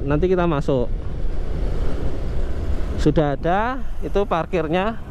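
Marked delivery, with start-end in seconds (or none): none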